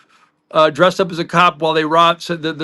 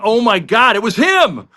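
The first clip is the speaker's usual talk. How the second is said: higher-pitched, retold astonishment; amazed recognition; 5 semitones higher, 4 dB louder than usual. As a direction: exclaim as if you've just recognized a famous voice.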